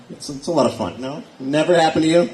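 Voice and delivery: ordinary delivery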